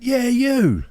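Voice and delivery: high-pitched mock holler; retold shout across table. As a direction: call someone out, imitating a shout. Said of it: "he's shouting across the table".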